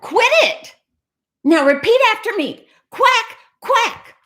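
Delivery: high, loud-edged scolding; exasperated high-pitched cat drilling a duck; frustrated cat. Acted. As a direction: order it in a high, fed-up voice. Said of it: "Getting frustrated".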